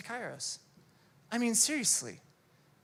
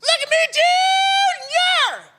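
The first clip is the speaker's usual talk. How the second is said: high, piercing, desperate fan yell; excited kid's scream; 15 dB louder, slower than usual. Retold or imitated. Little fan screaming at his hero behind the gate, piercing kid yell. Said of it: "It's pretty good impersonation".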